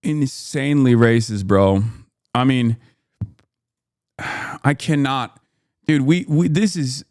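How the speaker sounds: loud, disbelieving outburst at the clip; exasperated outburst. Real, not acted. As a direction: blurt it out loud, fed up. Streamer reacting to racist clip.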